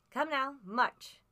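loud, slow, ugly order; cruel, cold command. A narrator's reading. Blind man interrupting the boy's plea, cruel and cold. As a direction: cut the boy off with a harsh command. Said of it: "a voice so cruel and cold and ugly".

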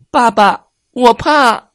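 put-on high, loud scared child cry; frightened shout. Cub Kaka hides from the crocodile, calling his father in a high frightened shout. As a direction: shout it from behind dad's back. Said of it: "大喊".

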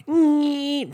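very high strained nyeh like a drill; imitated drill whine. Under the sink with the drill, a high drill-whine nyeh. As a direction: squeal it out like a power drill. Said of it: "this big fucking drill going".

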